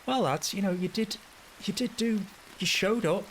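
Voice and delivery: high, chirpy, upbeat consoling voice; sing-song upbeat pep talk; cheerful inner voice. Retold or imitated. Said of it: "the voice inside my head was going".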